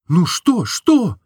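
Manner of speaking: put-on curious young woman; Nastenka curious. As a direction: urge him on.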